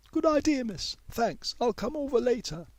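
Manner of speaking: put-on high, eager girl's reply; young pupil May. A narrator's reading. May thanking Miss Lomax, high eager voice.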